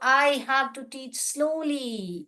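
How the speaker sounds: very slow, drawn-out words; teaching yourself to slow down, spoken slowly. Shown as a demonstration. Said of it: "बोल रही हूँ teach slowly".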